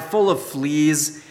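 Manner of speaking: normal talking voice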